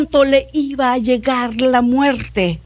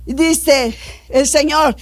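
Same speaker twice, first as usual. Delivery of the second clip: ordinary talk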